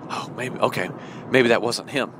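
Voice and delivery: low, soft, understated disappointment; a small deflated sigh of a line; mild letdown. Acted. Virgin realising it was not the bridegroom, mild letdown. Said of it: "And she's saying".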